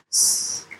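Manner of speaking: breathy, barely voiced little yes; hushed yes. Retelling her reaction to landing a client, whispered. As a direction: whisper a tiny triumphant yes. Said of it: "And I was like".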